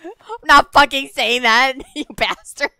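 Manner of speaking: high, loud words through laughter; real laughing fit. Real, not acted. Refusing teammate's word after being tricked, helpless laughing protest. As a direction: protest while cracking up.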